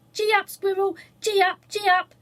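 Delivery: high, loud, gleeful shout; a small child urging on his big brother like a horse; loud high cheering shout. A narrator's reading. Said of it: "he shouted".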